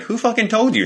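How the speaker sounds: sudden high pitched outrage from the owner; a squeaky, indignant who told you; caught-out owner. Pretend. Owner caught out, demanding who told on him in a high squeaky outrage. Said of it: "And they're like".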